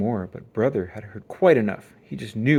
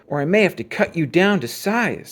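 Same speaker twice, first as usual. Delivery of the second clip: loud, higher warning; threat; 6 semitones higher, 6 dB louder than usual. Acted. Brother warning the bully, loud threat. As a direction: threaten him, steady and loud.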